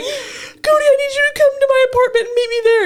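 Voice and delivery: high, loud, half-crying plea to a friend; scared plea. Retold or imitated. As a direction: beg for help like you're still shaken. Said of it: "I was so scared".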